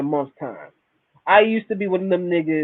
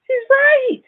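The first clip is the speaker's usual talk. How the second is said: she's right, cried out much higher; high incredulous exclamation. Real, not acted. Telling gamers the girlfriend is right, in a high exclamation. Emphatic repetition.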